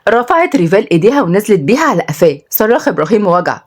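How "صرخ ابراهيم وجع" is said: plain reading voice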